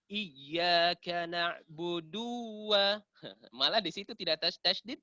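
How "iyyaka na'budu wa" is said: "na'budu" stretched to "na'buduuwwa"; very slow chant holding the du of "na'budu"; wrongly lengthened. Shown as a demonstration. Teacher imitates readers who prolong it, lengthened. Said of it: "Ada orang panjangkan".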